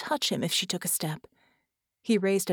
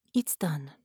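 quiet; 4 semitones lower, 3 dB quieter than usual. Acted. Soviet quietly finishes task.

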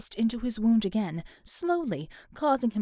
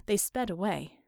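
usual speaking voice

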